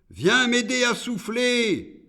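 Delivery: high-pitched call to the hurricane; demon summoning. A narrator's reading. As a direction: call out high, commanding the storm. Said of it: "dit-il à l'ouragan".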